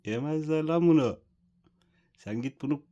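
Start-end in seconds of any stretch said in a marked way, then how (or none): 0.1-1.2 louder, higher scoff; mock outrage. Real, not acted. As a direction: scoff loudly at the animal's trick. Mocking the pet's trick.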